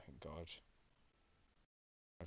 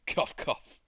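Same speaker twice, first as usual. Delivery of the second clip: loud, higher, breathy "cough, cough"; spoken fake coughs; 7 semitones higher, 7 dB louder than usual. Ghost faking coughs through the prophecy, loud and breathy. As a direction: cough the words out. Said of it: "spice stuck in my throat".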